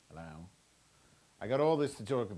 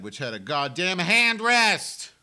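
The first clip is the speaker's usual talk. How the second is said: sudden furious outburst, much louder and higher; losing it; 7 semitones higher, 10 dB louder, slower than usual. Real, not acted. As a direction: snap and blow up over the hand rest. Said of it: "Sorry, I'm losing it".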